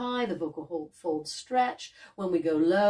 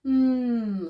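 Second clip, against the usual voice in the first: pleased mm as if tasting something good; appreciative hum; 4 semitones higher than usual. Shown as a demonstration. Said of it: "like we like something".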